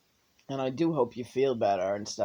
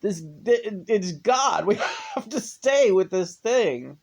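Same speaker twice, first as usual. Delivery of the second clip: snails speaking in awe; 6 semitones higher, 5 dB louder than usual. Acted. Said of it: "And they'll be like".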